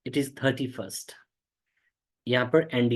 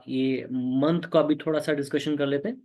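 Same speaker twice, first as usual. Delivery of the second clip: ordinary delivery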